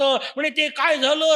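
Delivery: usual speaking voice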